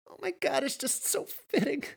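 very high, giddy squeak; hysterical laughing fit. A narrator's reading. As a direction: squeeze the words out through laughter. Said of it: "And she erupted again".

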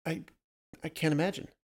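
hesitant, flustered stammer. A narrator's reading. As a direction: falter on the first word, then restart. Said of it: "she stammered".

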